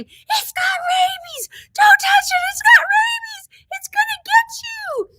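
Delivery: very high, crying shriek of warning; shrieking panic at a loose bat in the truck; panicked screaming. Retold or imitated. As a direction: scream in terror, near tears. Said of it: "I'm screaming now, saying".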